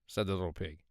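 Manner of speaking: ordinary delivery, nothing marked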